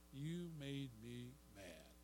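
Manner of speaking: a hushed, slow, sulky mutter; soft slow sulky excuse. Retold or imitated. Preacher quoting excuses, sulky soft mutter. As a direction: mumble the excuse like a sulking child. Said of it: "I hear that".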